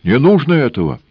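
high, loud protest; alarmed cry. A narrator's reading. Engineer cries out to stop the woman, high and loud. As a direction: burst out to stop her.

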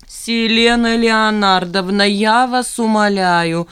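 loud, slow, drawn-out plea; pleading cry. A narrator's reading. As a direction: cry out, begging her to stop. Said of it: "закричал Эйхенбаум".

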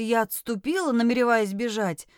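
plain reading voice

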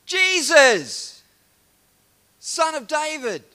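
full-force high shout; acted beggar's loud, high yell. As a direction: bellow desperately across a big crowd. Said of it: "He calls out even louder".

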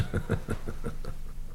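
tonloses kurzes Auflachen; leises gehauchtes Lachen. Acted. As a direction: ein Lachen nur aus Atem. Lachen nach Kompliment. (leises Lachen über das eigene Kompliment)

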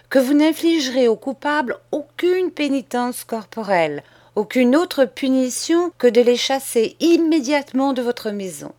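put-on a boy laying out terms, steady and sure; bold schoolboy.

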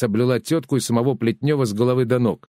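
ordinary delivery, nothing marked

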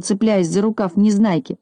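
plain reading voice

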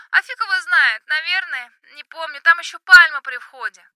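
high, loud yell into the phone; shouting over music. Acted. Drunk friend shouting in club.

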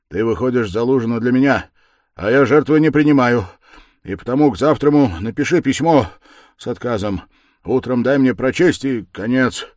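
loud, high, insistent; forceful ultimatum. A narrator's reading. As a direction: lay down the demands firmly. Raskolnikov giving an ultimatum.

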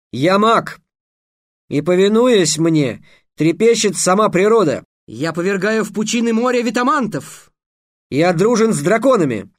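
loud, high declamation; grand boasting. A narrator's reading. Trix trying to convince all of his magic, boasting. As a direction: proclaim it like a mighty wizard. Boy wizard's boastful proclamation.

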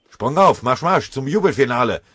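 high, loud bitter mock command; bellowed joke order. A narrator's reading. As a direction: bark it like a drill sergeant. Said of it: "brüllte der obergefreite Fuchs".

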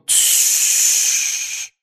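long hissed shush; sharp shushing. A narrator's reading. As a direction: hush him with a drawn-out sss. Wizards hush Harry at once.

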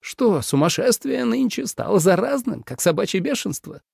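high, incredulous sarcasm; exasperated emperor. A narrator's reading. Насмешка раздражённого императора. (возмущённо и насмешливо, высоким голосом)

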